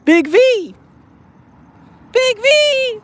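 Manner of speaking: high sing-song call to a cat; high pet-calling voice. Real, not acted.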